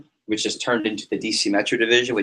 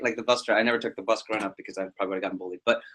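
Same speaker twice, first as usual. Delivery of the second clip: usual speaking voice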